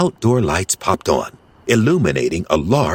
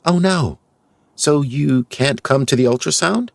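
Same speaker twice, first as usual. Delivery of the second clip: disappointed; 8 semitones higher than usual. Acted. Grace realizing disappointment.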